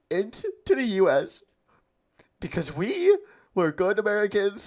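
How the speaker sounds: high, laughing mock sob; fake-cry but crack up laughing; laughing through fake crying. Real, not acted.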